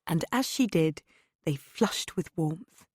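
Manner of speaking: ordinary delivery, nothing marked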